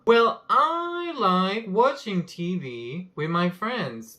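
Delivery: slow demo with a short pause after "I" for emphasis. Shown as a demonstration. Teacher demos "Well, I like" with a pause on "I". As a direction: hold a beat on "I" before the rest. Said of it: "j'ai fait une petite pause sur le I pour mettre l'accent".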